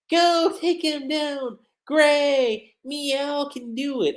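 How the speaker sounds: put-on high, slow, cutesy cat-girl voice; cat girl Wendy. Neko Wendy cheering Gray on in a high, slow cutesy cat voice. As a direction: purr it out like a little kitten.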